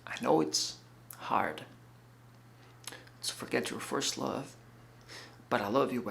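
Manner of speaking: subdued and hurting, with long pauses; tender plea. Acted. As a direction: plead quietly with the one you love. Pleading love to straying fiancée.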